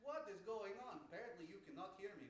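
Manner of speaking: ordinary talk, nothing marked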